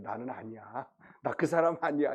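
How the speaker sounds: low, hushed retold denial; straight-faced denial. Peter to the servant girl, straight-faced denial. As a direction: deny it quietly with a straight face. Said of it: "정색을 하면서 이렇게 얘기하는".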